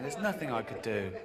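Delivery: ordinary talk, nothing marked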